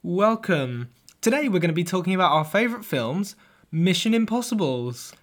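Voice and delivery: loud, high, fast radio host welcome; loud showman intro. Pretend. [name] hosting a mock intro for the Sharkman Show, hyped and loud. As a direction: sell the show like a hyped presenter. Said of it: "record a mock intro for the podcast".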